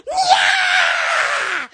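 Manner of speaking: long high screech, imitating a screaming toilet; mimicked high toilet scream; imitated scream. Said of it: "it starts screaming".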